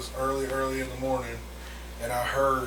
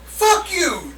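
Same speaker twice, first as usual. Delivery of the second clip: mock outrage. Real, not acted. Mock outrage over bees.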